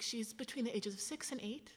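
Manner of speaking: hushed, unvoiced whisper; breathless whispered reverence. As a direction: whisper it like a secret. Host describing skull in hushed tone.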